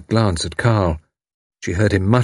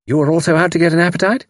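chatty lady; 9 semitones higher, faster than usual. A narrator's reading. Tuppence chatters.

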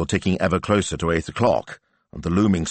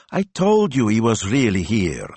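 higher, louder told-you-so; gloating son; 5 semitones higher, 3 dB louder than usual. Acted. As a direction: crow it at your father. Ivano gloating to his father.

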